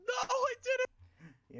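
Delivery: high, slow, loud wail of dismay; anguished yell. Real, not acted. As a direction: cry out in real despair. Player realizes spell missing.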